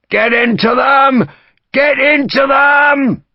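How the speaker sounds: high, loud, drawn-out urging; officer's shout. A narrator's reading. Officer urging his men onto the French, shouting. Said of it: "an officer of the Cauliflowers shouted".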